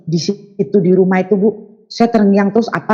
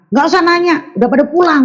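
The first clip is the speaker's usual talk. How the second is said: curt aunt; 9 semitones higher, faster than usual. Retold or imitated. Aunt's curt reply retold.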